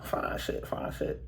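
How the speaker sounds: hushed whisper under the breath. Retold or imitated. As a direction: whisper it so she can't hear. Spotting the pretty assistant.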